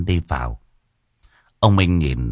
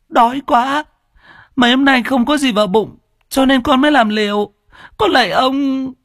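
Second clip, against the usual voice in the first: put-on much higher, near tears, pleading child; tearful girl; 4 dB louder than usual. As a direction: beg like a starving little girl. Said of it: "giọng như muốn khóc". (bé gái run rẩy sắp khóc, van xin)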